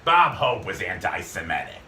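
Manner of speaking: put-on low bitter old smoker; smoker's voice. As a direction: toss it off like a bitter old star. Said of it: "that smoker's voice".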